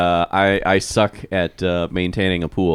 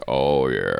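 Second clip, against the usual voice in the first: lower, softer sultry "Oh, yeah"; mock sexy voice; 8 semitones lower, 4 dB quieter than usual. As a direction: ham up a sexy purr. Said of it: "he thinks he's got the sexy voice".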